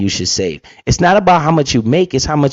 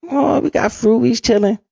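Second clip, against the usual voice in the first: much higher, soft, smug imitation; mock contented relative; 8 semitones higher, 3 dB quieter than usual. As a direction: play the smug relative proud of a fruit bowl.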